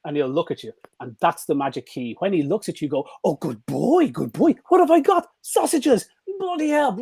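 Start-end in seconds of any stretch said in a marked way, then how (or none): 3.2-7.0 much higher, gushing praise for the dog; massive praise scene. Shown as a demonstration. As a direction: throw a party in your voice for the dog. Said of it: "You make a massive scene".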